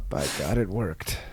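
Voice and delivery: low, soft awed murmur; mock reverent hush. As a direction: breathe it out in mock awe.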